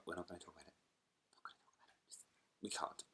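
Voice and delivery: hushed whisper, refusing to talk. Real, not acted. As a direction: whisper it like a secret. Refusing to discuss referendum.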